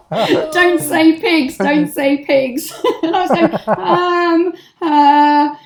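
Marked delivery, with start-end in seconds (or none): 0.5-2.7 slow, higher, half-laughing inner plea; telling herself not to say pigs, slow stifled laughing plea; panicked inner voice. Retold or imitated. 3.0-5.5 high giggly hesitation; trying not to laugh in front of the cop, giggly; stifled laughing. Real, not acted.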